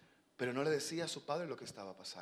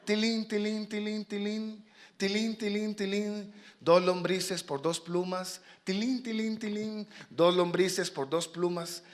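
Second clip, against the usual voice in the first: high, sing-song bell and sales call; street vendor's cry; 7 semitones higher, 5 dB louder, slower than usual. Acted. Fox hawking worms for feathers, sing-song. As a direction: chime the tilín, then stretch out the offer.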